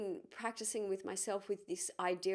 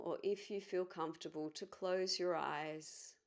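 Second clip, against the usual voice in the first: calm, measured guiding voice with long pauses; guided meditation. Acted. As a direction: ease listeners gently inward, leaving space between phrases.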